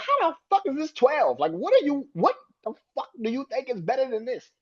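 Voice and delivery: high-pitched mock disbelief; mock outraged rant. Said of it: "I got so upset".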